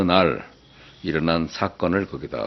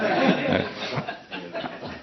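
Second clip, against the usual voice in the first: soft laughter; 5 dB quieter than usual. Real, not acted. Preacher laughs at own joke.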